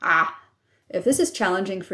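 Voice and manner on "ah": Ah said with a tightly constricted throat; Ah squeezed from the throat; saying ahh, throat squeezed. Shown as a demonstration. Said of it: "making an ah sound and constricting your throat".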